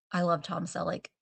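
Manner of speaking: ordinary delivery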